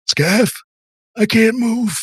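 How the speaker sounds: high, strained cry for help; panicked henchman. Acted. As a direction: cry out as if pinned and helpless. NPC pinned by crushing pressure.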